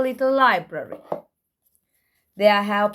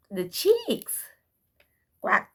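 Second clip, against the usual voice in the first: ordinary delivery, nothing marked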